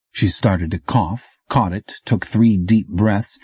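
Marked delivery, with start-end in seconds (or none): none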